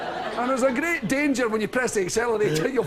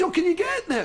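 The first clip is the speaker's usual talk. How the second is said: higher, laughing amazement; father amazed; 9 semitones higher than usual. Retold or imitated. The son retelling his father's eager question, higher and amused. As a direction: play the old father's wide-eyed surprise. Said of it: "He says".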